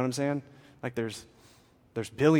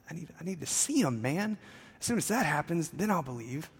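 higher-pitched, lightly voiced doubter; voiced sceptic; 6 semitones higher than usual. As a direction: play a doubter asking to be shown. Acting out a demanding sceptic.

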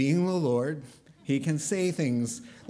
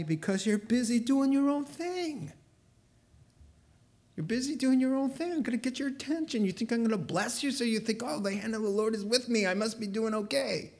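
much higher, quick and pressing, as God scolding his people; God's stern voice; 9 semitones higher, faster than usual. Acted. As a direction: rebuke them as the Lord, urgent and high. Preacher voicing God's rebuke.

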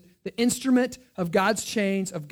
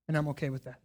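quiet acceptance; 4 semitones lower, 7 dB quieter, much faster than usual. Retold or imitated. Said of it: "he's ultimately saying".

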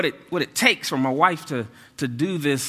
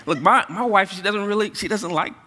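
ordinary talk

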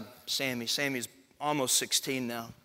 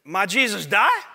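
imitated toddler, higher and loud, rising; louder child's question; 6 semitones higher, 9 dB louder than usual. Three-year-old asks dad again during pageant, louder. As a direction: ask it louder, like an insistent little boy. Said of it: "he got a little bit louder".

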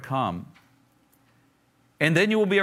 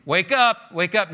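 louder, punchy paraphrase of a wake-up call; a sudden raised-voice alert; attention-getting call; 2 semitones higher, 4 dB louder, slower than usual. Retold or imitated. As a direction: call out to rouse inattentive readers. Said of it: "trying to get everybody's attention".

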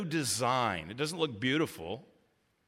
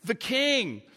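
high, urgent questioning of God; lamenting plea; 6 semitones higher than usual. Retold or imitated. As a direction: cry out why, pitch lifted. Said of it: "Surely that must have been the sentiment".

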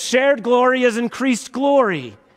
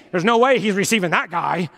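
mock judgmental voice. Voicing a judgmental believer.

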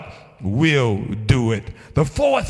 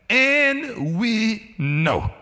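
"And we know" very slow, high, each word set apart; emphatic; 8 semitones higher, much slower than usual. Acted. Preacher countering mere guesses with Paul's words, emphatic. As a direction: stretch "And we know" as a certainty. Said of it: "Paul is emphatic".

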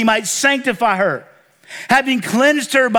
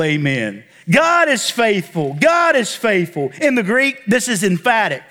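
normal talking voice

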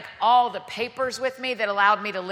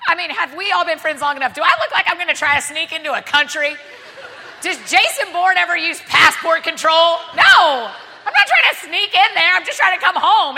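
exasperated plea; 5 semitones higher, 8 dB louder than usual. Retold or imitated. Exasperated pleading with officer.

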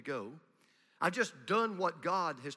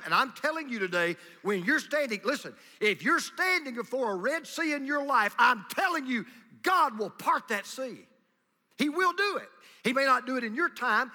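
louder, rising sermon proclamation; full-force preaching; 5 semitones higher, 6 dB louder than usual. Acted. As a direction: preach it out with conviction.

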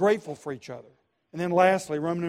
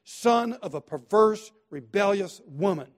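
angry king's rebuke; 4 semitones higher, 6 dB louder than usual. Retold or imitated. Saul berating his son Jonathan at the table, angry. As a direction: spit it out at him with contempt. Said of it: "That's what he's saying to him".

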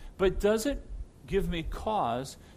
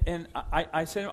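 normal talking voice

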